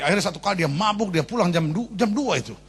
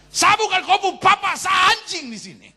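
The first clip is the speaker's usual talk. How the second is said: put-on higher, louder drunk father yells in fury; drunken angry shout; 14 semitones higher, 5 dB louder, slower than usual. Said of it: "Papa marah sekali".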